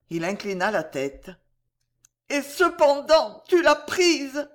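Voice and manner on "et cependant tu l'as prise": high, loud, slow accusation; acted wounded heroine, pitched up and slow; anguished reproach. A narrator's reading. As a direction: reproach your lover, drawing it out. Heroine reproaches her lover.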